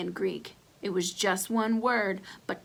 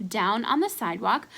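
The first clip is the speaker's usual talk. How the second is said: plain reading voice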